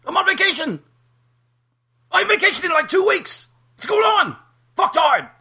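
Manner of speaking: imitating his own angry rant at a fan; mock outraged yelling. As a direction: yell it like a star pestered on holiday. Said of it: "I'm like".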